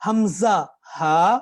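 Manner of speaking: حمزہ ہا slow, from the very bottom of the throat; slow throat letters. Shown as a demonstration. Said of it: "سب سے نیچے سے".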